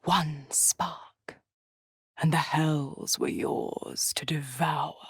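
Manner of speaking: low, soft, near-whispered lure; hushed temptation. Acted. Devil dangles the hells before a mortal, hushed and tempting. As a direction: tempt them under your breath, softly and low.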